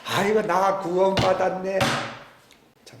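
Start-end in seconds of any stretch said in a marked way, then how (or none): 0.0-1.8 slow, loud, self-satisfied sigh of relief; mock smug relief. Preacher mocking a complacent believer, slow smug relief.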